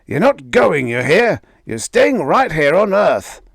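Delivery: high, loud furious order; shouting. A narrator's reading. Father shouting his daughter must stay, loud and high. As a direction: yell the order. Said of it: "Harley shouted".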